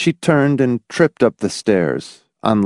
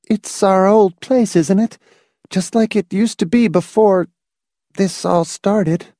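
anxious wife; 11 semitones higher than usual. Acted.

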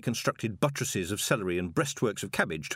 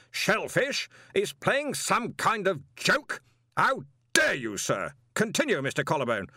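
sudden fury; 10 semitones higher than usual. A narrator's reading. Arch-Chancellor rebukes the intruder in his own college, furious. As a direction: put him firmly in his place. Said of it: "roared Ridcully".

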